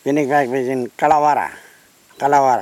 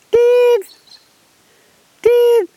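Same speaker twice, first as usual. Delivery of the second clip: very high, loud ringing note; bee-eater whistle; 6 dB louder than usual. Retold or imitated. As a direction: pipe one note like a whistling bird. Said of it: "he sound like a whistle".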